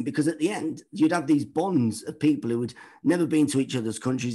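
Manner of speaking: ordinary delivery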